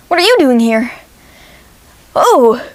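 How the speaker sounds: shocked to see him here; surprised. Retold or imitated. Narrator spots Yost Klein, startled and surprised.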